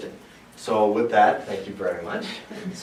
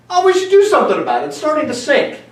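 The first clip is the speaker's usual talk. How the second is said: much higher, mock-naive voice of people who wait; mock bystander voice; 8 semitones higher than usual. Host mocks a healthcare system that waits, in a high mock-naive voice. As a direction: play the clueless crew shrugging at the sinking ship.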